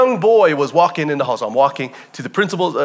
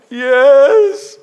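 put-on tearful boy; 10 semitones higher, 8 dB louder than usual. Crying boy agrees.

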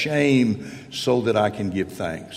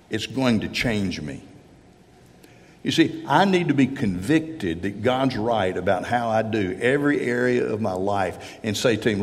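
ordinary delivery

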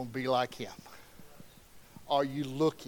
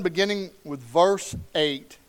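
usual speaking voice

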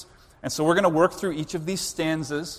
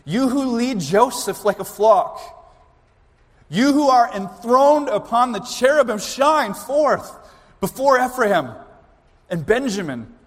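raised, measured reading of the psalm; solemn scripture reading; 5 semitones higher, 5 dB louder, slower than usual. Acted. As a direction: proclaim the verses slowly and solemnly. Reads the psalm aloud.

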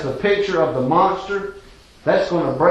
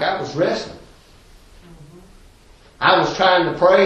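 normal talking voice

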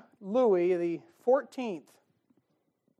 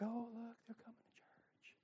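whisper; 6 semitones higher, 17 dB quieter than usual. Retold or imitated. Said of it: "people are like".

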